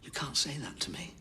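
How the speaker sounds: hushed, breathy plea; whisper. Acted. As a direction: whisper it like a wronged man. Accused defends himself in court.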